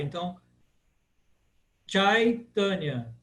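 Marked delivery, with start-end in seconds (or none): 1.9-3.1 Chaitanya with AI as in 'pai'; 'chai' rhyming with 'pai' in Chaitanya; ai as in pai. Shown as a demonstration. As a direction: slowly model Chaitanya, open 'eye' diphthong. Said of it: "como em pai".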